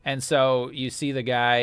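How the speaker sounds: ordinary delivery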